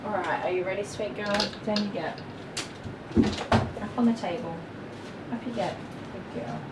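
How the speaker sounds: tender pet talk, softly urging her up; soft coaxing. Real, not acted. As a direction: ease her up onto the table, softly. Coaxing the dog onto table.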